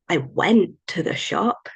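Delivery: WENT stretched and emphasised; stress on WENT. Shown as a demonstration. Teacher showing the class how emphasis changes meaning, stress on went. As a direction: lean hard and slowly on the word went. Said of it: "put pressure and emphasis on a different word".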